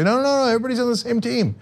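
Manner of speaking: high, quick, dismissive parody of pundits; mocking media voice. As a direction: brush it off like a smug TV pundit. Mocking mainstream media's line.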